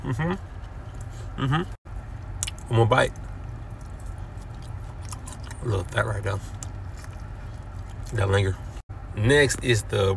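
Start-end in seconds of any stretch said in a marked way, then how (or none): 0.0-8.5 talking with mouth full; mumbling between bites; chewing. Real, not acted. Seen 0: bites pork pieces at 2.0 and 5.6, chewing between bites.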